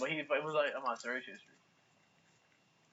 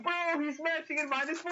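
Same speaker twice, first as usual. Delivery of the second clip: high, slow mock Ben voice; hammed-up friend impression; imitating Ben; 12 semitones higher than usual. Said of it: "Ben was like".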